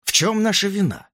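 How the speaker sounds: insistent. A narrator's reading. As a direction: press the doctor's demand for an answer. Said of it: "настаивал доктор Уоллес". (настойчиво)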